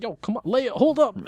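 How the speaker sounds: very high mock call; Luke calling after Leia.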